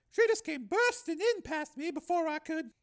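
the flustered secretary apologizing; apologetic secretary. A narrator's reading. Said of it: "his secretary said".

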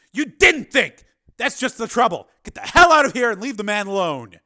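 loud, furious dressing-down; angry scolding. A narrator's reading. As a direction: tear into him for his blunder. Boss scolding the other man.

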